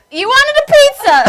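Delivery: high, put-on Italian accent. Shown as a demonstration. Comedian echoes the host's pizza line, Italian accent. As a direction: copy the mock Italian line. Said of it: "maybe I can do an Italian accent".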